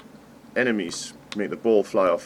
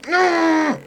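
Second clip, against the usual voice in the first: very high anguished shriek; scream; 5 dB louder than usual. Real, not acted. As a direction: wail the no in despair. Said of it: "grunting and screaming and abuse".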